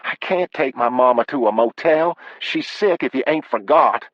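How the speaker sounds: high-pitched, exasperated wife; high voice of a wife pushing back; protesting wife. Acted. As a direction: object in a high, upset voice. Wife objects to husband's plan.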